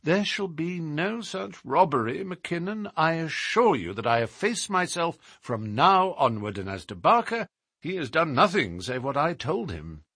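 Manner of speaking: animated detective in story dialogue; assured detective. Acted. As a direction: voice a self-assured sleuth making a promise. Holmes voiced in story dialogue.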